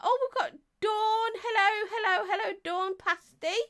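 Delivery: high-pitched welcome with repeated hellos; excited greeting. Real, not acted. Greeting viewers as they join.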